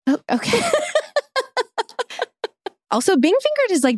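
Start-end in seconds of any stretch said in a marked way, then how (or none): 0.6-2.7 a high, soft, breathless laugh; high quiet laugh. Real, not acted. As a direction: laugh softly, pitched way up. Laughs at fingering story.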